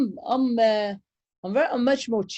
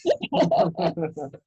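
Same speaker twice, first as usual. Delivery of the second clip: real laugh at the joke; 2 semitones lower than usual. Real, not acted. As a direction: burst out laughing.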